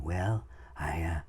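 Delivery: lightly imitated president, soft and hesitant; Ronald Reagan. Coach showing presidents with personality, soft Reagan impression. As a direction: softly hem and haw like an old-time president. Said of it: "and Reagan".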